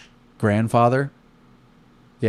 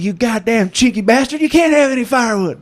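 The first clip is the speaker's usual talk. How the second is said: put-on furious high shout of a grizzled old vet; angry old veteran; 8 dB louder than usual. Old Vietnam vet yelling at the campers, high furious shout. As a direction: scream it like a cranky old soldier. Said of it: "he's like".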